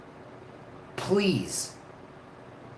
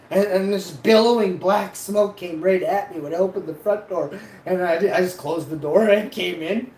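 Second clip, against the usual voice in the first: excited laughing; 5 semitones higher, 4 dB louder than usual. Real, not acted. Retelling smoke pouring from house.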